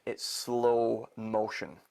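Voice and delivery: "It's slow motion" drawn out slowly, each word weighted; slow emphasis. Shown as a demonstration. Magician to spectator, planting the detail with slow stress. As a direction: stretch "slow motion" right out so it sticks. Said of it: "I put emphasis on a detail".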